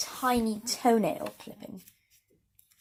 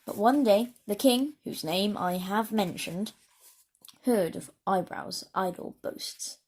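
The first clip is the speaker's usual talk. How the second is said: ordinary delivery, nothing marked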